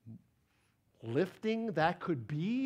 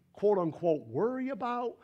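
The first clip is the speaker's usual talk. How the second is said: ordinary talk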